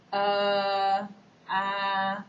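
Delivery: a drawn-out hesitant "uh", twice; filler uh. Shown as a demonstration. Teacher showing students a filler sound, hesitant uh. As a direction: demonstrate a speaker stalling with "uh". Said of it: "filler words like er".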